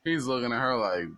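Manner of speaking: ordinary delivery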